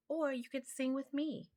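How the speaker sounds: lightly voiced young girl kitten. A narrator's reading. Narrator voices kitten Marie.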